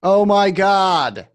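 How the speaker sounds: exasperated vape clerk fed up with the same question. Real, not acted. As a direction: drag it out, totally fed up.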